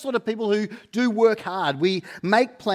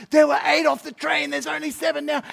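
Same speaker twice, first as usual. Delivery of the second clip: panicked shout; 10 semitones higher than usual. Retold or imitated. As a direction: yell it like a dad in full panic. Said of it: "I'm like".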